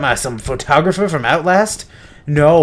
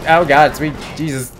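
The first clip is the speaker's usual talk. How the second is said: normal talking voice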